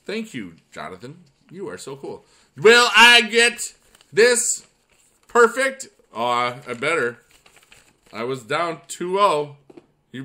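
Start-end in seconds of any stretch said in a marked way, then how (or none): 2.6-5.9 loud, high, spaced-out words; big dramatic build-up question; excited suspense. Real, not acted. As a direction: announce it slowly, pausing for suspense. Suspense over a perfect game.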